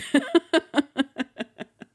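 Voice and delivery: a high, delighted giggle. Real, not acted. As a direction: giggle like a fangirl caught gushing. Giggle after gushing over Gambit.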